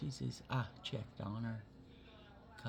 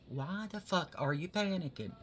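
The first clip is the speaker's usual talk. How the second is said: exasperated yell; 6 semitones higher, 5 dB louder than usual. Retold or imitated. Retelling her talk with Kim.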